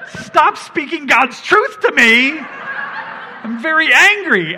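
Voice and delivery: hammed-up angry dad, half laughing; mock exasperation. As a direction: pretend outrage that cracks into laughter. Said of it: "I'm just staring at him like".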